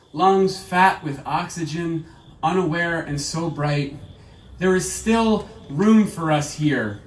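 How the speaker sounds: measured recital, line by line with pauses; poetry reading. Acted. Poet closing the reading, measured poetry recital. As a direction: recite each phrase deliberately, letting it land. Reads the last poem.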